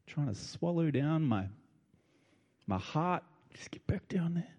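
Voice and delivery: quiet, choked-up voice, fighting tears; a real catch in the throat, held back; choking up. Real, not acted. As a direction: swallow the emotion and push the words out softly. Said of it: "I'm choking up".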